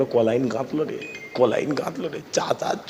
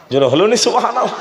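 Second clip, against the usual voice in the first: laughing; 8 semitones higher, 6 dB louder, faster than usual. Real, not acted. Laughs while asking the crowd.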